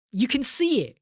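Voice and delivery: high-pitched incredulous protest; exasperated. Real, not acted. Streamer to customers asking what toppings there are, exasperated. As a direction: stress the obvious, pitch shooting up. Ranting at the obvious.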